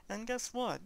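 normal talking voice

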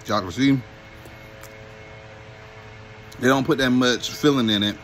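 eating pastry while speaking; chewing while talking. Real, not acted. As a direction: mouth partly full of food. Seen 0: Bitten patty held at mouth; lips chewing through early and middle frames.

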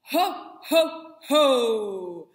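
loud, punchy ho ho ho from the belly; belly-driven loud ho ho ho; diaphragm ho ho ho. Shown as a demonstration. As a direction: pump out three loud ho's from the diaphragm. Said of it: "give a nice".

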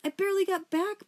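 high, loud, tearful protest; acted sob while explaining yourself; sobbing. A narrator's reading. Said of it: "Poppy sobbed".

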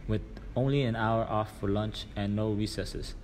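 plain reading voice

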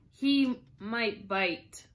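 slow, drawn-out cautious warning; a careful, hesitant reply, taken slowly. A narrator's reading. Alice warns the Duchess about the flamingo, cautiously. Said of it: "Alice cautiously replied".

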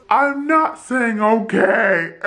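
loud, drawn-out refusal, imitating a patient; defiant mock yell. Woman in labor refusing to comply with the staff, defiant yell.

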